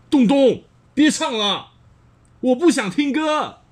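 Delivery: high, loud angry call; yelling father. Acted. Father yelling at Dongdong to stop singing. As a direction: shout the boy down from the doorway. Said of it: "指着东东大叫".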